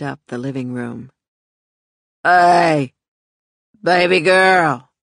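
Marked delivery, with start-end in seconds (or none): 2.2-4.9 put-on drunken loud drawl, slow; slurring loudly like a drunk uncle; loud slurred drunk. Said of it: "came a loud, slurred voice".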